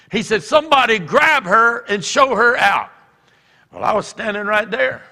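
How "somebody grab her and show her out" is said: loud, raised-pitch command; shouted order. Retold or imitated. College president ordering the woman removed, loudly. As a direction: call out for help across the room.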